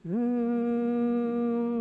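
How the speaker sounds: sustained hum; one long steady hum. Shown as a demonstration. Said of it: "and let's hum".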